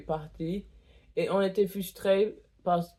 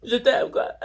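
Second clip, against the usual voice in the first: laughing; 6 semitones higher, 6 dB louder than usual. Real, not acted. Gushing thanks to the piercer.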